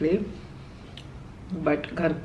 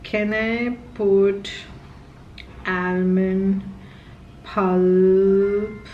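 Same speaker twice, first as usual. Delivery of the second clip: slow, deliberate voice-search query; slow careful question; much slower than usual. Real, not acted. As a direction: spell it out slowly for the assistant. Asking Google a voice search.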